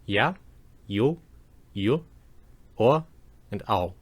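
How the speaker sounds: each diphthong said alone, pauses between; diacritic pronunciation. Shown as a demonstration. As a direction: say ya, yu, yu, o, ao as separate model sounds. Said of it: "I'll pronounce them for you again".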